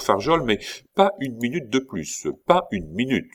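pas une minute pushed up sharply; firm insistence. Acted. Commandant warning Farjol about the deadline, firm.

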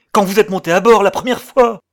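very loud, high frantic demand; frenzied shout. A narrator's reading. Man questioning sailor about first boarding, frenzied shout. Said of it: "cria mon ami avec frénésie".

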